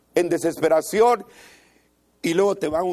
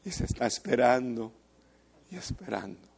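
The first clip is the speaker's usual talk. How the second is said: fading; 7 semitones lower, 7 dB quieter, faster than usual. Acted. Narrated God waiting, fading.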